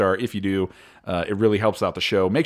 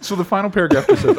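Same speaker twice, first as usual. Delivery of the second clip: much higher, louder, fast and laughing; 10 semitones higher, 6 dB louder, faster than usual. Real, not acted. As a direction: rush on through giggles. Host still laughing, reading on.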